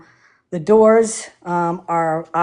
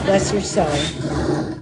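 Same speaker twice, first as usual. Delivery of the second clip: folksy Southern blessing; 4 dB quieter, much slower than usual. Retold or imitated.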